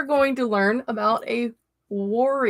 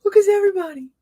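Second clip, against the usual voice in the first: high, loud, near-squealing hellos; squealing with delight at familiar names; excited greeting; 13 semitones higher, 8 dB louder, faster than usual. Real, not acted. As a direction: gush over friends you haven't seen in ages.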